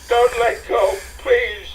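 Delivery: high, loud frightened plea; panicked begging; alarmed pleading. Acted. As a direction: beg him not to let go. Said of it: "Alarm riddled her voice".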